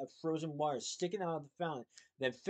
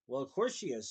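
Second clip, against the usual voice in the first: ordinary delivery, nothing marked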